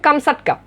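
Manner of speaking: "কামসাটকা" said kam-sat-ka; correct pronunciation. Shown as a demonstration. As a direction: repeat "কামসাটকা" as a model, "sat" in the middle. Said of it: "প্রকৃত উচ্চারণ হচ্ছে".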